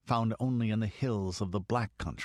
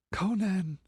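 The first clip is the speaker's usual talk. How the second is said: higher, pleading call, a little weak; faint whimper; 10 semitones higher than usual. A narrator's reading. The exhausted girl calls to her hidden protector, faintly whimpering. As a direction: whimper his name for help. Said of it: "she whimpered faintly".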